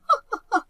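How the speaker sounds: short embarrassed laugh; sheepish laugh. Acted. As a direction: laugh it off awkwardly. Awkward laugh after apology.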